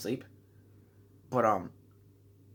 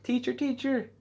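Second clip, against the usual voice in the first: calling teacher; 12 semitones higher than usual. Retold or imitated. Calling the teacher after landing.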